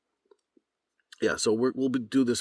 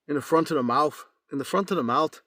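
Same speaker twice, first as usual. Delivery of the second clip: Irish accent; 8 semitones higher, 4 dB louder, faster than usual. Shown as a demonstration. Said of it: "Now from Ireland".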